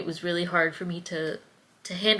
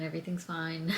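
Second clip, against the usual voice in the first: ordinary delivery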